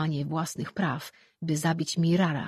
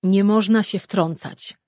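slow, louder, firm declaration; firm statement; 3 semitones higher, 8 dB louder than usual. A narrator's reading. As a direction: state it as final, slowly. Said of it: "oświadczył stanowczo Lore".

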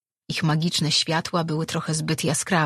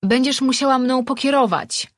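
calling back; 8 semitones higher, 5 dB louder than usual. Acted. Servant calls through rock gap.

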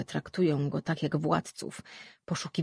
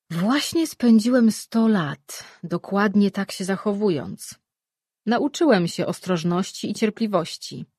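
lightly voiced weary Mirar; 3 semitones higher, 7 dB louder than usual. Mirar answers defensively.

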